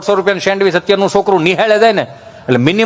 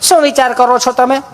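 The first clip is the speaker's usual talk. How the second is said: lightly voiced sweet nurse, a bit higher; 5 semitones higher than usual. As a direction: ask like a polite nurse. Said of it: "nurse બેન". (મીઠા અવાજે nurse)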